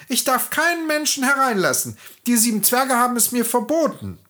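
put-on high girlish voice. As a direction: refuse dutifully through the window.

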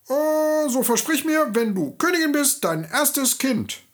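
high piping goblin voice, slowing at the end; sly little man. Acted. The little man demands the girl's first child, high and sly, slowing at the end. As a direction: coax out the promise like a sly goblin.

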